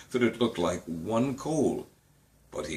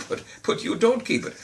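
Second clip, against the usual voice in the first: startled protest; 12 semitones higher, 3 dB louder than usual. Acted. Nephew protests.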